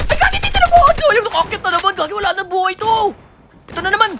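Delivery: panic. Real, not acted. Alarm at losing the fight.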